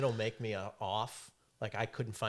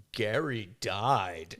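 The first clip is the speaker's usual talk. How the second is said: very slow, a different voice; deadpan lie; 2 semitones lower, 5 dB louder, much slower than usual. Retold or imitated. Lying to the Toyota manager, slow and deadpan. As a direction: fake a solemn death notice. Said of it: "be like".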